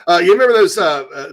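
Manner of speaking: ordinary talk, nothing marked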